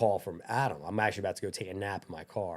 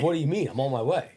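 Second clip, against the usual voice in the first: higher, louder mock indignation; getting mad; 7 semitones higher, 6 dB louder than usual. Client told not to come in, getting mad. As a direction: protest loudly like an annoyed client. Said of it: "he's getting all mad".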